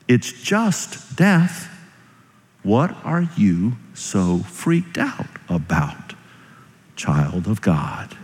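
slow, softened, measured questioning; gentle reassurance. Acted. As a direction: let each word settle slowly and quietly. Repeats the message slowly and softly.

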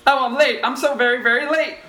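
high, panicky little rabbit voice, squeaking out no time; a flustered rabbit who is terribly late. Acted.

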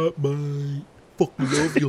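hammed-up mock British accent. Asked for a British accent on stream, cheeky mock British accent. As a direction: toss it off in a cartoon British accent. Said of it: "do your best American accent badge and British accent".

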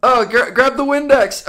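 loud, high urgent call. Acted. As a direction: shout for the Windex in panic. Acted urgency in scene.

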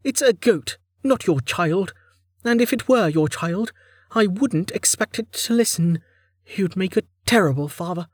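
high-pitched weary scolding; tired. A narrator's reading. Lin chides Carten for babying the goat, tiredly. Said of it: "Lin said tiredly".

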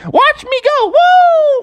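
very high cartoon bird voice; piercing eagle squawk; show-off eagle. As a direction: squeal it while taking flight, ending in a loud whoop. Eddie the Eagle takes off.

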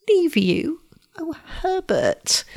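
high, slow shocked whisper; tender surprise. Acted. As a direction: gasp it out in disbelief. Shocked at his fear.

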